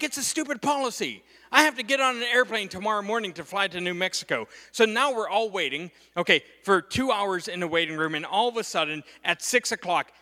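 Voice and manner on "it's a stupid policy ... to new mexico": high-pitched exasperated argument; acted-out griping, pitched up in protest; indignant retort. Dad arguing with his son over policy, indignant.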